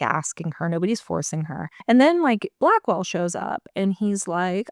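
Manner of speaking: ordinary talk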